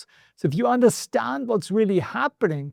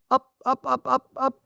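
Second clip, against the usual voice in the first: higher, quick repeated dog call; 4 semitones higher than usual. Real, not acted. Host to his sleepy dog Winston, calling it up. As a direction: coax the dog up with short, higher calls.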